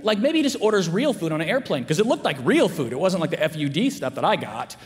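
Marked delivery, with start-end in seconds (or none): none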